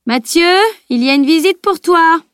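high, loud call up the stairs; mother calling her son down to the door; calling upstairs. A narrator's reading.

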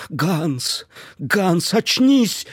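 rising desperate plea; pleading. A narrator's reading. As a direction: beg him, voice climbing high. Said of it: "молила Эдит".

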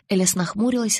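plain reading voice

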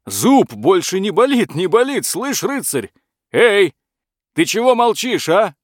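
high, loud, overjoyed calling out; excited dragon. Acted. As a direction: call the knight in glee.

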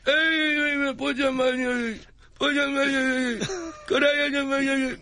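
high, slurred rock-star mumble. Acted. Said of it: "[name] at Subway Sandwich".

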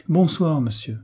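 impatient goodnight, high on the first word. A narrator's reading. Lady leaving the salon on the baron, impatient. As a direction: cut the talk off with a curt farewell. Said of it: "dit impatiemment madame de la Roche-Aiguë".

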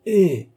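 a sharp, high, loud devilish "Eh!"; demonic exclamation. A narrator's reading. Old baron grinning at his son, devilish high "Eh!". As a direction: snap it out with a wicked grin. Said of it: "avec son sourire de démon".

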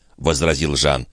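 usual speaking voice

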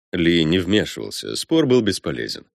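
ordinary delivery, nothing marked

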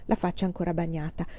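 usual speaking voice

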